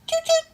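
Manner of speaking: high, whistle-like choo-choo; train whistle. Pretend. Owner making train noise for the dog, high and playful. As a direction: squeal it like a toy train whistle.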